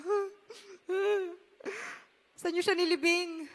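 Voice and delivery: soft, tearful asking through a cry; preacher voices the weeping Jesus asking where Lazarus lies, soft and tearful. Acted. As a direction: ask where he lies with a catch of tears. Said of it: "Sabi niya".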